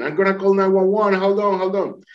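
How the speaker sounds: high, sustained calling-out voice; imitated urgent call. Friend on the hill to the drowning man, urgent call.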